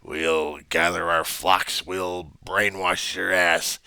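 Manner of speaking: slow, menacing cult leader; dramatic acting. Shown as a demonstration. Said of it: "Notice the dramatic acting at this point".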